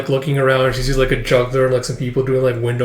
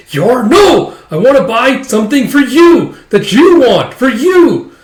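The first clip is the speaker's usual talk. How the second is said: loud, high-pitched acted outburst; hammed-up frustrated yelling; exasperated protest; 10 semitones higher, 8 dB louder, slower than usual. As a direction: wail in mock frustration, leaning on each phrase. Brother protests sister buys for others.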